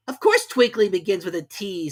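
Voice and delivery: high, loud and sharp; high, loud sharp king. A narrator's reading. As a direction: snap at the Hatter. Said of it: "said the king sharply".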